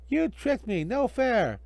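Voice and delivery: high whiny protest; a hint of a cheated villain; indignant magician. Hinkle cries out, tricked.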